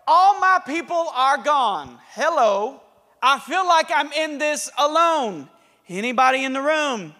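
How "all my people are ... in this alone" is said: plaintive complaint. Acted. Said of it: "He tells the Lord".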